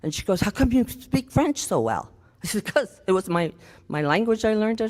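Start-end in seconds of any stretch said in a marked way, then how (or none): none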